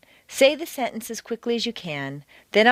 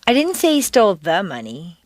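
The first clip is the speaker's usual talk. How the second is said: "the" emphasized, said in full before "money"; stress on THE; 4 dB louder than usual. Shown as a demonstration. Teacher indicating a different sum, stress on "the". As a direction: punch "the" to single out that money. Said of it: "a different amount of money".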